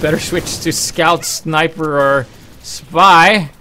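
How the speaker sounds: loud, drawn-out scornful lecture; emphatic, loud put-down; mocking scolding. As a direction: scold a dumb teammate, dragging out each class. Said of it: "Is usually what they say".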